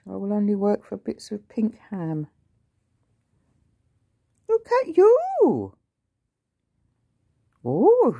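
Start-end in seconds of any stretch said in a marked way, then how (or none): 0.1-2.3 mock whisper as the cat; voicing the cat in a quick whisper; cat's voice. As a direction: whisper the cat's demands, fast and haughty. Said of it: "She says".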